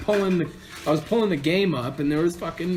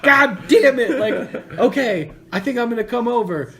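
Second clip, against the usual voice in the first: loud, higher, laughing curse; laughing frustrated yell; 11 semitones higher, 8 dB louder than usual. Retold or imitated. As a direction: shout it out half laughing. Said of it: "And I'm like".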